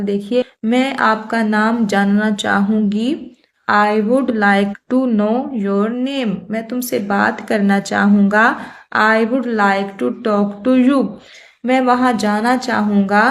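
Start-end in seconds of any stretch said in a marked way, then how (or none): 3.7-6.3 slow, word-by-word example sentence; slow dictation. Shown as a demonstration. Teacher reading an example to Hindi-speaking students, slow and deliberate. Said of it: "teacher reads English example". 9.0-11.0 slow, word-by-word example sentence; slow dictation. Shown as a demonstration. Teacher reading an example to Hindi-speaking students, slow and deliberate. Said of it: "teacher reads English example".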